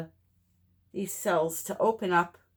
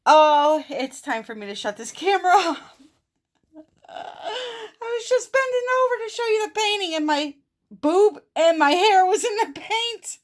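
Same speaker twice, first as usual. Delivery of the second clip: laughing; 12 semitones higher, 7 dB louder than usual. Real, not acted. Embarrassed laughing outburst.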